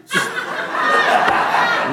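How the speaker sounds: airy laugh, way up high; breathless high laugh. Real, not acted. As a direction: laugh out in disbelief. Overcome recounting the teaching.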